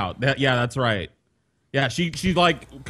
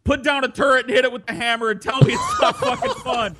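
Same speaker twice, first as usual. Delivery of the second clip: heated enthusiasm; 8 semitones higher than usual. Real, not acted. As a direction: argue excitedly, breaking into a laugh at the end. Heated defence of a game.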